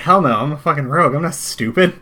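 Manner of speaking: indignant rogue. Retold or imitated. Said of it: "She's like".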